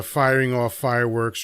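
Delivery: ordinary delivery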